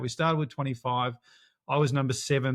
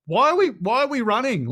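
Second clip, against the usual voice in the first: high, loud, baffled cry of a confused runner; panicked racer; 10 semitones higher, 5 dB louder than usual. Retold or imitated. Mid-race to the runner beside him, high confused yell. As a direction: squeal it like you've no clue why you're running.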